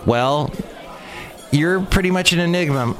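normal talking voice

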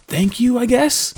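high, unsure, questioning thanks; hesitant thanks. Acted. Drew accepting unwanted protection from his companion, hesitant. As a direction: thank them doubtfully, pitch lifting.

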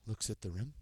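hushed golf commentator voice. Shown as a demonstration. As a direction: murmur it like a golf broadcast. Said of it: "Have to work in my, in my golf voice".

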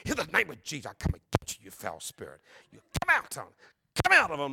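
strained, hammed-up deliverance prayer with sudden bursts; imitated exorcist preacher. Preacher hitting draft stopper over him, fierce mock exorcism. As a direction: mimic a preacher casting out spirits, jumping in pitch. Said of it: "he's going, and he's hitting this thing".

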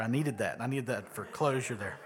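ordinary delivery

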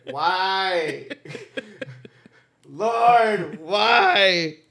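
high, laughing cry to the heavens; mock anguished plea. Begging the Lord why visitors came, mock-anguished wail.

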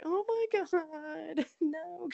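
high, slow stretched-out dismay breaking into a laugh; shocked disbelief. Retold or imitated. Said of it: "And I was like".

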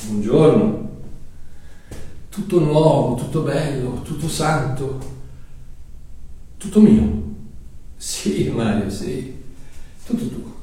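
quiet preaching voice; hushed sermon narration. Acted.

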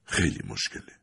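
soft, low, resigned father's reply; hushed and weary; quiet father. A narrator's reading. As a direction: say it quietly, heavy with doubt. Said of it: "پدر آرام گفت".

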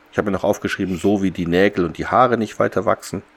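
ordinary delivery

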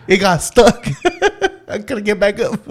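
high, broken-up words through laughter; laughing while telling. Real, not acted. Telling how the recliner got stuck, laughing. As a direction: fight through giggles to get it out. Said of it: "laughing through the story".

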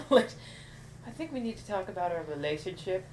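lisped relationship as lelish. Acted. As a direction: say relationship with a lisp. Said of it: "I was texting with my lisp".

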